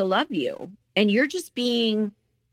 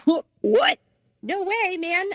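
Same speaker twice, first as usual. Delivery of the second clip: shocked disbelief; 11 semitones higher, slower than usual. Retold or imitated. Woman to boyfriend at midnight, high incredulous protest. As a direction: react to a midnight demand in outrage. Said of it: "And I was like".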